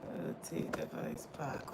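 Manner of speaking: slow whisper, reading aloud to yourself; whispered to self. Real, not acted. Streamer reading a phone prompt, slow whisper. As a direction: whisper the prompt as you read it.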